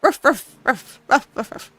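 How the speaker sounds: playful barks, ruff ruff, like a small dog; dog barking. Acted. Reader voicing the dog Ulysses barking at Clarice, playful barks.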